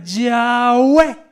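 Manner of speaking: "Yahweh" with a long "yaaa" gliding into "weh"; sounded-out Yahweh. Shown as a demonstration. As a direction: stretch the first vowel of "Yahweh", then land on "WEH". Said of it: "cuando tú tratabas de pronunciar las consonantes".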